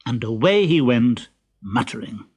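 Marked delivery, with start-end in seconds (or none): none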